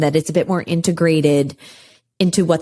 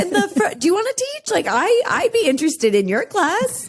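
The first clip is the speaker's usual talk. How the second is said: high-pitched, impressed mock offer; playful deference; 12 semitones higher than usual. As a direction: hand over your job in mock awe, pitched up. Jokingly offers student the teacher's role.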